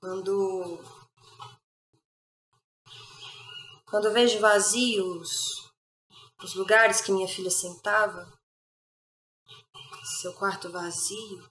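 hushed, low lament with long pauses; quiet tragic lament; grieving mother. Acted. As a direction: mourn your murdered child softly, pausing often. Mother laments her killed daughter.

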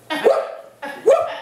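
high laughing whoop; sudden high whoop through laughter. Real, not acted. Exclamation with laugh.

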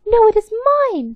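high, loud No, it is mine; angry cry. Acted. Lame mouse shouts down her sisters, high and loud. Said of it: "cried the lame one".

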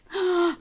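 a sharp storybook gasp; dramatic gasp. Acted. Storyteller gasping at the thunderstorm, a sharp dramatic gasp. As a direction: gasp at the coming storm for the children. Thunderstorm scene in picture book.

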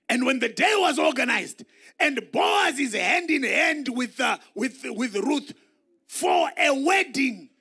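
preacher shouting to stir the church; full-force preaching. Acted. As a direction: preach it out loud to the congregation. Preacher shouting wedding scene.

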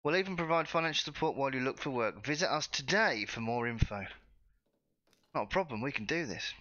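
normal talking voice

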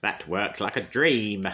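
slow, loud gloating witch voice. A narrator's reading. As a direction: savour the victory wickedly. Witch gloats after spell.

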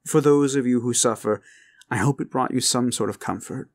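plain reading voice

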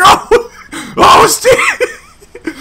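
high, loud oh shit through laughter; laughing panic. Retold or imitated. Teller recalling the driver braking, laughing high panic. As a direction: crack up while panicking.

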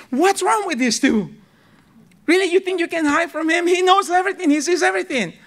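lightly voiced angel, rising in disbelief; baffled angels. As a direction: scoff at the hiding pair, gently incredulous. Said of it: "the angels are scratching their heads like".

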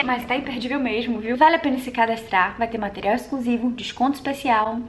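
ordinary talk

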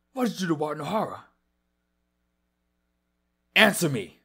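alarmed demand. Acted. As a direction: push him hard for an answer. Demands answers from changed friend.

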